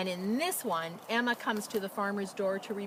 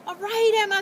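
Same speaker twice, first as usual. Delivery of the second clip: higher, louder happy cheer; 14 semitones higher, 11 dB louder, faster than usual. Acted. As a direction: cheer the hen as the hero. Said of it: "they cried".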